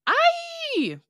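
sudden high yelp; a shrill surprised yelp as the floor drops; startled yelp. Acted. Reader voices the character falling, startled yelp.

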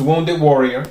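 ordinary delivery

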